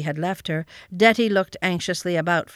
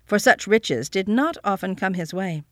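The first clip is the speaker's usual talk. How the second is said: ordinary delivery, nothing marked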